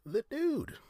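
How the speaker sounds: high, goofy take on the Dude; voicing the Dude. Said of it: "Okay, I won't do it anymore".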